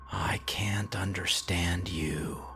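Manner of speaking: slow, breathy whisper, barely there; hushed whisper. A narrator's reading. Darren whispering slowly to the signal in the dark control room, hushed. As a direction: whisper it slowly, as if afraid to disturb it.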